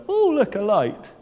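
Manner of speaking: a hint of a gullible fishy; naive little fish. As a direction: play the fish, dazzled by the lure. Little fish sees the lure.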